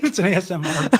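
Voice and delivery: laughing. Real, not acted. Laughing at the eating noise.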